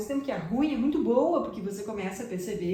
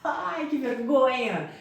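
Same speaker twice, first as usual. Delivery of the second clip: loud, higher, drawn-out cringe; embarrassed cringe; 5 semitones higher, 5 dB louder, much slower than usual. Acted. Learner not understood by a gringo, loud drawn-out embarrassment. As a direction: groan it out slowly in mock mortification.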